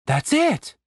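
high, cheering praise; excited. Acted. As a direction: cheer them on. Catherine encouraging.

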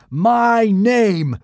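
high, loud god voice; booming. Acted. Boy posing as Thor booming at the Romans. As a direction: boom it out like a god. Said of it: "boomed Hiccup".

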